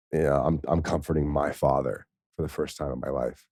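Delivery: choked-up, emotional recounting; real tears. Real, not acted. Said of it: "I'm crying now".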